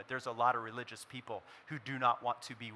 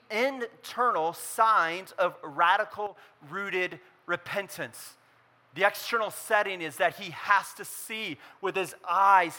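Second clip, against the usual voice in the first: raised, driving sermon voice; impassioned preaching; 6 semitones higher, 9 dB louder than usual. Acted. Pastor urging the congregation, preaching at full force.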